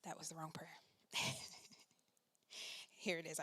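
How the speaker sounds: usual speaking voice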